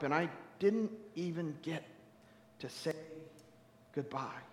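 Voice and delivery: low, fading, halting lament; quiet grief. Acted. Mourner who never said goodbye, quietly grieving. Mourner listing sudden deaths.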